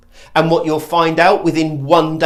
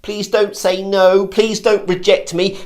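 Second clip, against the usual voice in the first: slow, higher hopeful plea; needy questioning tone, raised pitch; pleading hopefulness; 4 semitones higher, slower than usual. Shown as a demonstration. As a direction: beg not to be turned down. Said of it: "Can you hear the hopefulness?".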